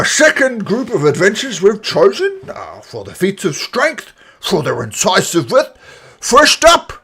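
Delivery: put-on booming old orc addressing the crowd; elderly orc announcer.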